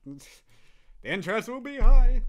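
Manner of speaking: high, loud mock hype; playful hyped-up boast. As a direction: shoot it up, half joking. Hyping the title.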